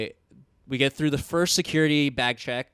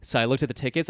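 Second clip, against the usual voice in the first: normal talking voice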